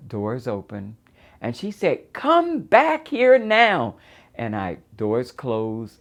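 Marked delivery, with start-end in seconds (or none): 2.1-4.0 high, loud, stretched-out yell; imitating a mother's furious yell; screaming command. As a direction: scream it word by word down the hall. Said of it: "she's yelling and she's screaming".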